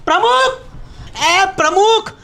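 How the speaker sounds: high, urgent holler; alarmed calling out. Acted. Resident calls society chairman about an intruding buffalo, alarmed calling. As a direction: call for help in a high, strained voice.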